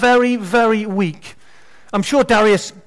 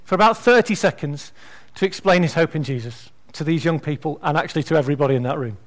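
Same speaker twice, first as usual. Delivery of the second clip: ordinary talk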